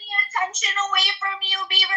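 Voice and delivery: high, whiny mockery, pitched well up; mocking whiny attention-seeker. Mocking a streamer who wants attention, high and whiny. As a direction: parody him as a needy teenage girl. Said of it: "I'm a grown man with the emotions of a teenage girl".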